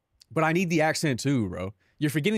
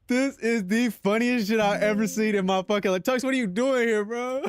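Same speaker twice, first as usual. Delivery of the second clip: ordinary delivery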